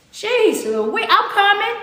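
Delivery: high, drawn-out protest; playful exasperation. Real, not acted. Host answering fans who demand a tour stop, playfully exasperated. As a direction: give in with a high-pitched, rising protest.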